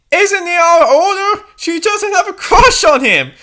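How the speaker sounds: high, loud scandalised reader; mock reader's voice. Narrator voices an imagined scandalised reader. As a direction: voice the shocked reader. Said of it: "Reader, you might be thinking".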